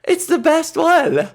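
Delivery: high, slow put-on voice. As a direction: proclaim it in a silly voice. Put-on voice praising the seasoning.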